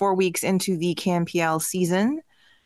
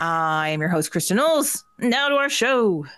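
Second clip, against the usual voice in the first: ordinary talk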